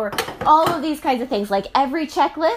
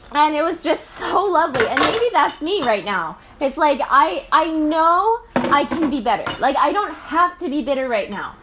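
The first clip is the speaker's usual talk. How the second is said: ordinary delivery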